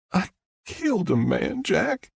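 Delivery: high, anguished confession; distraught. A narrator's reading. Star tells Jack she killed a man, distraught. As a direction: rise into distress on the name.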